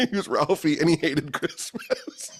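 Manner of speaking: high-pitched, laughing through the words. Real, not acted. Telling a friend the cousin was Ralphie, real laughter. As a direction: crack up at your own punchline.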